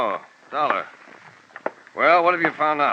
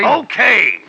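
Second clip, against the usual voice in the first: angry; 8 semitones higher, 9 dB louder than usual. Acted. Angry fleet owner snaps.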